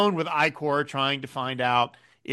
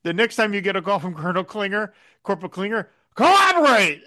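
Colonel Potter impression; 4 semitones higher than usual. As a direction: lean hard into collaborate. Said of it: "when he says".

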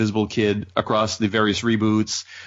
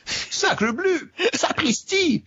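high, hammy fake French cry; mock French exclamation; much slower than usual. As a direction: squeal it like a cartoon Frenchman. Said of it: "bad French in comics".

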